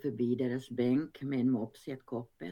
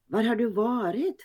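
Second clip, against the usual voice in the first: tart; 8 semitones higher, 5 dB louder than usual. A narrator's reading. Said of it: "frågar pinnen syrligt".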